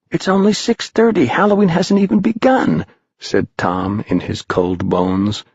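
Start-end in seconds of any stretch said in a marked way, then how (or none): 0.1-2.8 put-on a much higher young boy's voice; eager boy. Tom protesting to the stranger, high and boyish. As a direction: pipe up like an eager kid.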